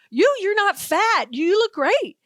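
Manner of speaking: high, slow, emphatic imitation of astonishment; exaggerated mock amazement. Media man meets a TV host in person, exaggerated amazement. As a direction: marvel at them, pitched well up and stretched out. Man's tactless amazement, repeated.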